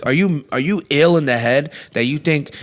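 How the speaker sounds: normal talking voice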